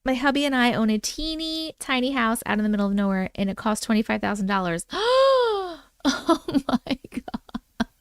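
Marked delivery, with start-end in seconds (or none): 4.9-7.9 sharp high gasp, then a slow "Oh my God"; shocked gasp. Real, not acted. Streamer reacting to a twenty-five thousand dollar house, stunned gasp. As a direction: gasp in disbelief at the price.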